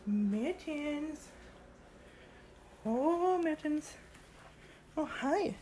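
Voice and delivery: high, sing-song voice for the cat; cat-calling voice. Real, not acted. Owner coaxing her cat Mittens, high sing-song.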